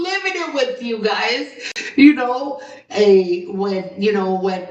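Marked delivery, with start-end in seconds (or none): none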